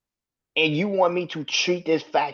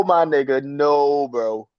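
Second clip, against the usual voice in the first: ordinary delivery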